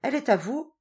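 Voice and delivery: quick, eager, pitched high; eager question. A narrator's reading. Teresa eagerly asking about the goat. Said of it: "demanda vivement Teresa".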